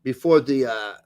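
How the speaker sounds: put-on lightly German-accented coach quote. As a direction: read it like Pochettino, German accent. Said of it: "your German accent for Poach".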